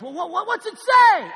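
high, loud, impatient yell; Martha yells. Acted. Martha yelling back at Philemon, eager to hear. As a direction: shout back from the other room, eager. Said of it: "Martha yells".

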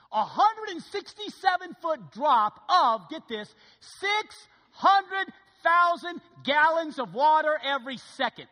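wowing the church with Niagara stats; awed emphasis. Real, not acted. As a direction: drive home the huge numbers. Awed delivery of statistics.

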